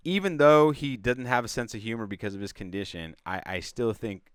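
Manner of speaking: ordinary delivery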